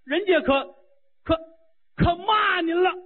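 high wailing complaint, near tears; a disciple sobbing and shouting to her master about the insult; whining cry. Acted. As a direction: cry out the grievance like a wronged child.

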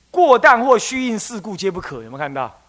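ordinary delivery

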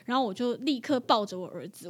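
ordinary talk

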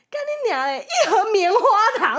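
high, loud, laughing disbelief; laughing outburst. Real, not acted. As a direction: blurt it out between laughs. 揭晓礼物时爆笑大喊. (又笑又叫地揭晓)

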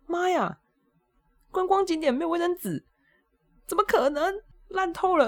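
high, fed-up rant about the empty toilet; exasperated outcry. Retold or imitated. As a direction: wail it in mock despair. Inner outcry about no paper.

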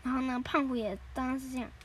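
usual speaking voice

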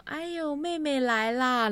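high, drawn-out exclamation of welcome; loud welcoming boss. Retold or imitated. Department manager greets the young colleague, loud welcome. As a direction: hail the junior colleague with a big stretched cry. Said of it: "因为他是很大声的".

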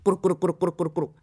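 very fast bubbling noise; rapid glug-glug sound effect; underwater gurgling. Acted.

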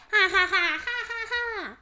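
jeering monster laugh; high mocking laugh; mocking laughter. A narrator's reading. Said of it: "又开始嘲笑了起来".

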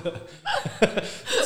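very high, sustained laugh; peal of laughter. Real, not acted. Two hosts reminiscing about old phones, high laughter. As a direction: keep laughing helplessly.

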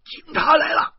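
high, loud alarm cry; sudden piercing yell of warning; shouted warning. A narrator's reading. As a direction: shout it out over a crowd. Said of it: "不知谁喊了一声".

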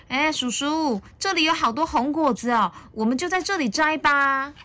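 put-on high-pitched child voice; high, keen storybook youngster; eager little dinosaur. A narrator's reading. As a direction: pipe up excitedly to an uncle. Little dinosaur calls excitedly to uncle.

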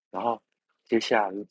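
ordinary talk, nothing marked

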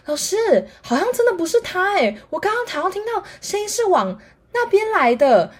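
put-on high, earnest boy's voice; lightly voiced boy speaking up for his friend; classmate's objection. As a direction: politely point the teacher the other way. Classmate defending Wang to teacher.

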